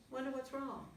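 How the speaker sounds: ordinary delivery, nothing marked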